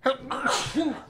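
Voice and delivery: sudden high sneeze; a real sneeze bursting out; sneezing. Real, not acted. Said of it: "J'ai éternué".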